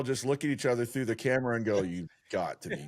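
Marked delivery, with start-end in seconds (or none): 1.8-2.9 mildly lowered, incredulous mock reaction; deadpan disbelief. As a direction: say it low, as a knowing aside. Acts out their shared on-camera reaction.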